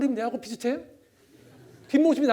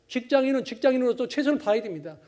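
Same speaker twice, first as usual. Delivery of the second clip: usual speaking voice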